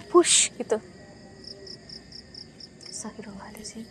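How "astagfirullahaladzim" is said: scared whispered prayer. Real, not acted. Host startled by a noise.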